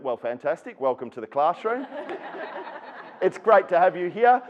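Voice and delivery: high and loud, laughing through it; mock cheerful kid. Imagined Morris welcomes the new teacher, high, loud and laughing. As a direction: play the dream student, laughing.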